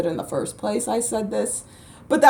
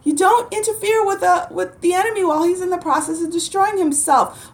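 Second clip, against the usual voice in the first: the maxim squeaked much higher; mock preaching; 9 semitones higher than usual. Lecturing upset light workers, a much higher excited proverb. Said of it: "I'm like".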